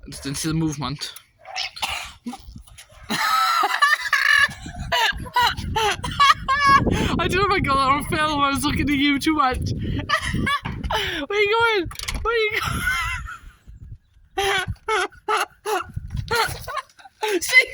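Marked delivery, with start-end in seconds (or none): 1.5-7.1 high shrieking laugh; real helpless laughter; loud laughing fit. Real, not acted. Bursts out laughing at the dog. 7.2-17.7 high, loud, breathless through laughter; words bursting out mid-laugh; laughing while talking. Real, not acted. Still laughing, chasing the dog.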